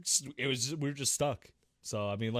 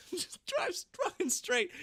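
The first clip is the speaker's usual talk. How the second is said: very high, slow laughing squeal; laughing disbelief. Real, not acted. Disbelief at the friend's driving, high laughing squeal.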